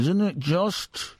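slow, drawn-out put-on voice; impression of a mate. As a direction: draw it out in a mate's voice. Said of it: "that's not a very good impression".

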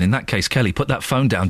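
ordinary talk, nothing marked

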